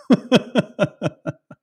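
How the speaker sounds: sudden high burst of laughter; loud laugh. Real, not acted. Host laughs at video game footage.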